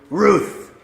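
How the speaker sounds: high, loud bark of a word; one big doggy woof; talking dog. Acted. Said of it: "And Buddy goes".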